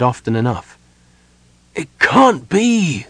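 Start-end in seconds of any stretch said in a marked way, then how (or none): 1.7-3.1 high, loud, drawn-out cry of frustration; frustrated cry. A narrator's reading. Sylvan faces the impossible shield, crying out in frustration. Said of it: "cried in frustration".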